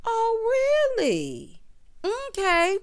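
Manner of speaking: high, loud "oh" dropping into doubt; mock surprise. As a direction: react with exaggerated, sceptical disbelief. Sceptical reaction to Jackie's claim.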